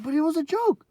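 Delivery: high, loud whining; high squeaky defence to the principal; whiny protest. Retold or imitated. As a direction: plead it in a squeaky kid voice. Mock whiny own protest.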